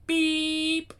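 high, held electronic tone; imitated machine beep. Reader imitating an answering machine, high beep. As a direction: sing out a steady answerphone beep.

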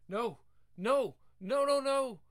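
anguished shout. Acted. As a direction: scream it out in grief. Boy learns his dog died.

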